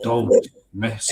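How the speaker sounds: slow, low, ominous teaser; sinister voice. Pretend. Host teases the Amityville show, slow sinister voice. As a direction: draw it out low and soft, sinister. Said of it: "That was my sinister voice there".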